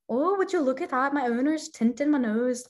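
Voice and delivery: high, lilting Irish dog voice; light Irish accent. Retold or imitated. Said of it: "kept doing Irish voices for".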